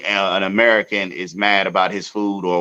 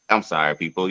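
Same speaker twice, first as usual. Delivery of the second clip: ordinary talk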